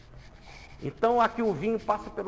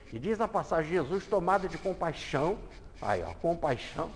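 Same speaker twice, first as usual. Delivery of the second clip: normal talking voice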